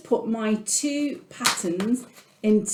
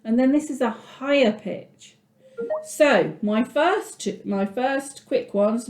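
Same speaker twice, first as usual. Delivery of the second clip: ordinary talk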